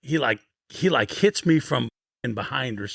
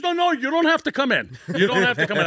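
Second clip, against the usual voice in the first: high, rushed insisting voice; insisting coach; 10 semitones higher than usual. Retold or imitated. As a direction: wave him off, no need to come.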